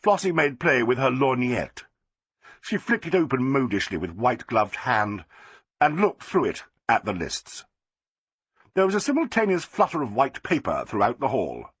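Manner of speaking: ordinary delivery, nothing marked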